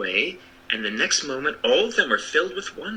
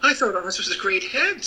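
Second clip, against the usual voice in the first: put-on little girl voice; 8 semitones higher than usual. A narrator's reading. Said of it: "said Dorothy".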